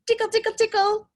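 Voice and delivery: high, fast tickle tickle; playful tickling. Acted. Octopus tickles her children, high and quick. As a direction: tickle the little ones playfully.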